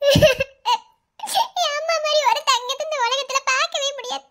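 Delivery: grief-stricken sobbing lament; tearful outpouring, gasping between phrases; crying. Acted. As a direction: push the words out through heavy sobs. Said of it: "Tamil அழாதம்மா".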